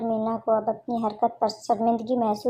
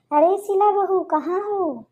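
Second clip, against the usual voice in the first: second call, higher and more insistent; 8 semitones higher than usual. Acted. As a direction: raise the voice, she didn't answer. Calls again, louder. (ऊँची, ज़ोरदार दूसरी पुकार)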